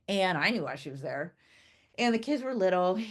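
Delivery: normal talking voice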